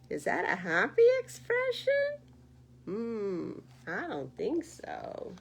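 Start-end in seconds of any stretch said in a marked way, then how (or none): 0.1-2.2 exaggerated question. Real, not acted. Asking babies, exaggerated.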